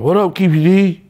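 high, loud mocking call; mock jeering greeting; taunting inmate. Inmate taunts him from cell.

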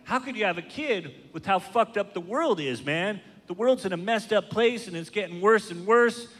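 high, whiny voice of preachy friends; mocking doom-saying friends. Comic mimicking his friends who said having a kid was wrong. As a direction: sneer it like a know-it-all friend. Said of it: "they were like".

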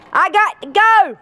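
high, loud, slow and insistent; urgent shout. Acted. Mother rushing off to search, shouted slowly.

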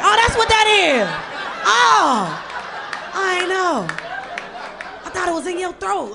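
high-pitched mock realization; slow-witted audience member. Crowd member who failed biology finally gets the joke, mock dawning realization. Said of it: "Everybody that failed biology laugh at boob".

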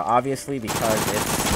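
ordinary delivery

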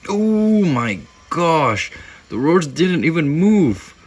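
loud, slow, high astonished cry; real disbelief, draw out the oh; amazed shout. Real, not acted. To viewers as the roach freezes, a loud astonished oh my gosh. Roach unexpectedly stays put.

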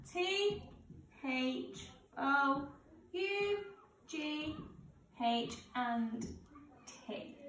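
spelling out letters one by one; slow school dictation. Acted. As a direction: spell each letter clearly for children. Teacher spells out the answers.

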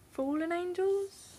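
high, uncertain guess at the title; unsure question. Real, not acted. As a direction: ask it as if unsure of the name.

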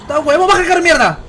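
loud, high yell to get out of the car; furious order from a carjacker; angry shout. Acted. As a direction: bark at the driver to get out. Yelling at driver to get out.

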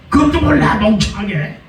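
high, mocking put-down; scornful parent. Parent belittling a child who can't answer, high mocking scorn. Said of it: "막 그러잖아요".

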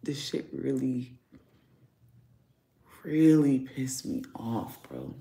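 slow, soft and worn out; letting the frustration sink in, close to crying; tearful anger. Real, not acted. Venting to viewers over spoiled meat, slow and tearful. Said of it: "I'm about to cry right now".

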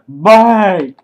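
very loud, high bang; acted explosion. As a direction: shout it as a sudden blast. Acts out the sudden death.

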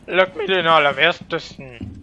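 loud frustrated curse; annoyed outburst. Real, not acted. Gamer cursing at lag, loud frustrated outburst. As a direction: vent at the lagging game.

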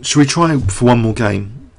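usual speaking voice

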